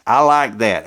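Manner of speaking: ordinary talk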